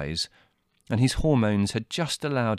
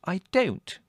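high-pitched indignant sister; 12 semitones higher than usual. A narrator's reading. Margot denying her brother's accusation, indignant. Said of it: "said Margot indignantly".

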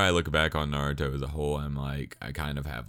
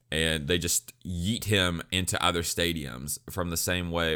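ordinary talk, nothing marked

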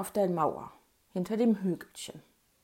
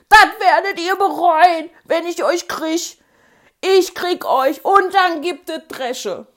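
wailing threat, much higher and louder; screaming threat; 8 semitones higher, 13 dB louder than usual. Retold or imitated. Bully threatens kids from the mud, screaming. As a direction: howl the threat while crying. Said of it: "er schrie und heulte".

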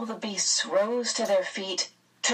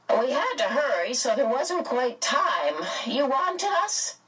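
put-on high-pitched, lilting old-friend character voice; Mrs Whatsit; 7 semitones higher than usual. A narrator's reading. Mrs Whatsit greeting the beasts who wanted them, high and lilting.

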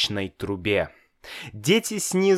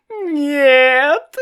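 high, loud bawled no; bawling; 8 dB louder than usual. A narrator's reading. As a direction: howl it like a crying child. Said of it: "ревел Славик".

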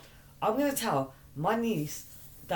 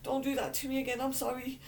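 high, wailing plea rising at the end; pleading apology; 5 semitones higher than usual. Real, not acted. Begging a friend not to hurt her, pleading apology. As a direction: beg for mercy, pitched up high. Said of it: "I got the apology I wanted".

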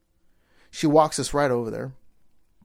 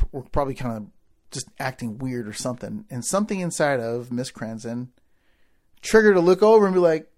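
ordinary delivery

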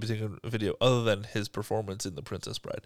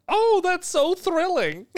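much higher, slow, gushing mock delight; imitating Mandy; 5 dB louder, much slower than usual. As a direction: gush it in a giddy over-the-top voice. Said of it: "And he's just like".

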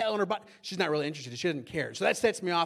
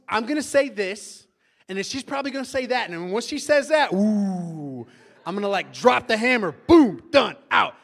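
loud, building to a shouted finish; combative rehearsal; 3 semitones higher, 8 dB louder than usual. Retold or imitated. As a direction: gear up for the fight, then shout the knockout. Rehearsing a fight, building to knockout.

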